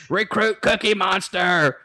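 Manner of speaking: slow, drawn-out drill instructor call; called out nickname. Retold or imitated. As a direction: call the recruit out slowly.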